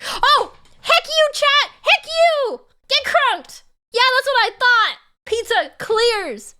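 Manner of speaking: high-pitched indignant yelling; mock outrage. Streamer telling off chat over pizza, mock outrage. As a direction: play up outrage in a high, loud squeal.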